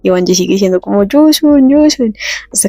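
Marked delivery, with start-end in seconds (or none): 1.0-2.1 flirty calling. Retold or imitated. Banji flirting with Yusun in front of everyone, high teasing calls. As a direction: coo her name up high to tease her. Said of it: "Le coquetea todo el tiempo".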